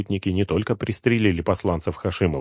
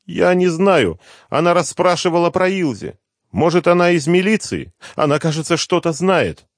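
high, loud, breathless rush of worry; a terrified mother pouring it all out; mother telling her son the girl was taken, in panic; panicked mother; 11 semitones higher, 5 dB louder than usual. Acted. Mother voiced, frightened.

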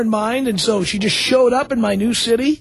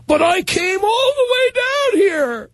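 whiny plea; 10 semitones higher, slower than usual. Retold or imitated. Ex-fling pleading, quoted.

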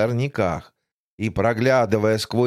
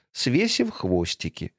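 plain reading voice

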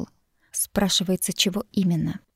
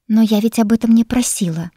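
louder, snapping retort; snapping back; 4 semitones higher, 7 dB louder than usual. Acted. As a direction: bite back at him. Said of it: "огрызнулась я". (огрызаясь)

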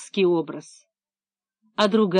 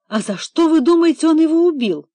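agitated question; 7 semitones higher, 5 dB louder than usual. Acted. Levitsky agitated interrogation question.

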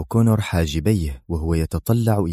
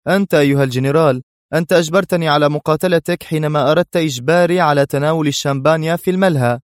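fast, higher, louder mockery; sarcastic; 8 semitones higher, 3 dB louder, faster than usual. A narrator's reading. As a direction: rattle it off with a smirk. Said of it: "أجابه أدهم في سخرية". (سخرية سريعة بصوت أعلى)

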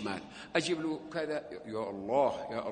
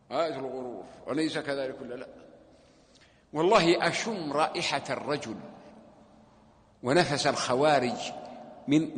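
ordinary talk

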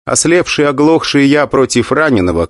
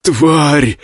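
hoarse; 4 semitones higher than usual. Acted. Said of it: "прохрипел ректор".

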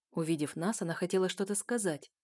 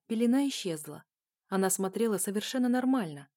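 ordinary delivery, nothing marked

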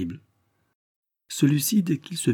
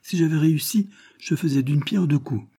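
high-pitched swaggering brag; cynical boasting; 6 semitones higher than usual. A narrator's reading. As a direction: keep bragging, voice pitched up. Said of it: "avec une cynique forfanterie".

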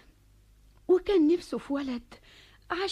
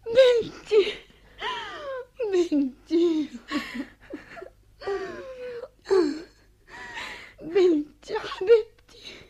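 tearful outcry; 7 semitones higher, much slower than usual. Acted. Mother finally calls her child daughter, tearful outcry. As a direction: wail it through sobs.

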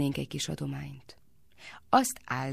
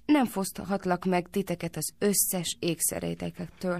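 plain reading voice

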